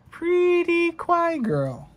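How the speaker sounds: high, slow sing-song coo. Pretend. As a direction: coo it like a doting fan. Cooing at pretty card.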